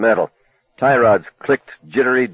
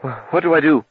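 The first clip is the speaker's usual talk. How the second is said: panicked; 7 semitones higher than usual. Acted. Lee panicking in cab.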